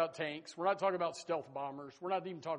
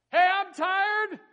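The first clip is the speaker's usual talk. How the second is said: high, loud, slow whine; mock whiny complaint; 15 dB louder, much slower than usual. A tired churchgoer complains to a friend, high slow whine. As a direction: stretch it out like a grumbling churchgoer.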